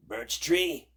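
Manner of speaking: loud calling out; witch yelling. Acted. Baba Yaga yelling at the birch tree. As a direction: yell up at the tree. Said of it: "yelled the witch".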